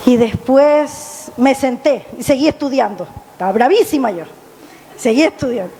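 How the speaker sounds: ordinary talk, nothing marked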